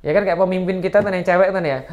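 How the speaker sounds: normal talking voice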